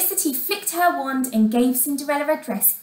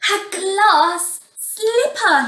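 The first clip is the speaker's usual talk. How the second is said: slow, raised cry of discovery; 8 semitones higher, 4 dB louder, much slower than usual. A narrator's reading. Prince finds the slipper on the steps, crying out. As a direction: draw the words out high in amazement. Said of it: "he cried".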